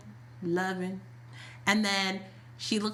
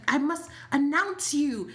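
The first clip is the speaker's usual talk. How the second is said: higher, slow, prim gatekeeper voice; mock-formal, proper announcement; prim assistant; 6 semitones higher, slower than usual. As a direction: stand firm like a fussy assistant. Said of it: "Suzanne wasn't having it 'cause she's like".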